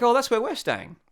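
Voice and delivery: high-pitched retold surprise; imitated delighted girls. Girls learn he shares their hotel, high imitated delight. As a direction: mimic their pleased discovery, pitched well up.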